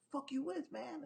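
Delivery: high, lightly voiced insult; a touch of menace; mock hostile. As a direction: toss it back like the angry guy. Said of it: "he's like".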